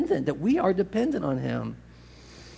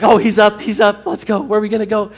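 eager dog; 5 semitones higher, 7 dB louder than usual. Retold or imitated. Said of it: "he's like".

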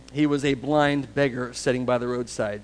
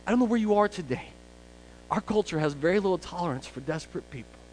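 ordinary delivery

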